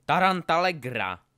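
loud, drawn-out incantation shout; roared spell. A narrator's reading. Harry fires a spell back at Draco, roaring. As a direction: shout the spell slowly and forcefully. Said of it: "zařval Harry".

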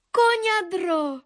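high, drawn-out call. A narrator's reading. Modřinka calls to her cousin behind her, drawn-out call. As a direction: call back, stretching the word.

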